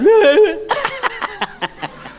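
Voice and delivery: shrill hammed-up cackle; high mock laugh. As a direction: burst into a high fake laugh. Mourners laughing mid-wail.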